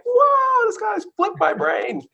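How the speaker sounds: high shocked yell, slowing down; a big astonished cry of disbelief; stunned shout. Retold or imitated. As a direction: blurt it out like your mind just got blown. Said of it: "and I'm like".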